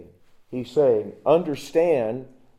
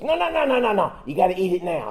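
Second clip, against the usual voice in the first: put-on high, eager kid insisting; insistent kid; 7 semitones higher, 5 dB louder, faster than usual. Junior high boy insisting the pastor eat the candy, high and eager. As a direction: push it like a pushy little boy.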